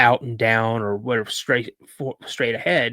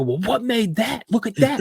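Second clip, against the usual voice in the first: put-on high-pitched, insistent outburst of a vindicated kid; indignant kid exclamation; 12 semitones higher, 3 dB louder than usual. Boy showing dad the dented camper, indignant exclamation. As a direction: exclaim it high, demanding that he look.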